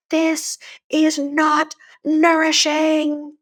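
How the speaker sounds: high, slow mock yell; mock yell, high and drawn out. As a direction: cry it out from the cliff, stretched and high. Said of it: "I yelled really loud".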